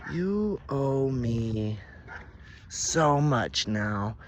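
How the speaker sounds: loud, drawn-out demand; menacing demand. A narrator's reading. She confronts the man she punched, loud slow demand. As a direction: stretch every word as you lay claim.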